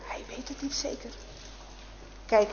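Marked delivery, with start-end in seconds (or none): 0.1-1.1 unvoiced, hushed aside; whispered doubt. A narrator's reading. Storyteller about Francis's doubt, whispering.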